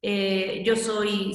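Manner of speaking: usual speaking voice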